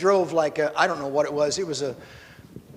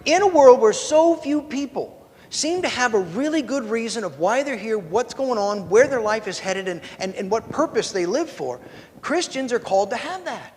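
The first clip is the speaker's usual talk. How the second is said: full sermon swing; preachy sermon; 4 semitones higher than usual. Pretend. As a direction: preach it to the back row. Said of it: "I'm gonna do it anyway".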